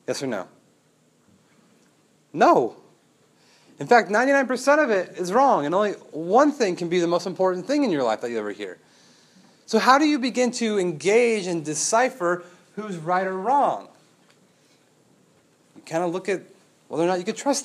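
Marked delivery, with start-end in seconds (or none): 2.3-13.9 forceful preaching. Acted.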